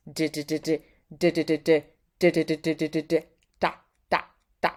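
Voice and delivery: repeated "d, d, d" tapped fast on the tongue; tongue drill. Shown as a demonstration. Teacher doing a "d" tongue exercise for students. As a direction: rattle the "d" like a mouth workout. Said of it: "you see me doing it".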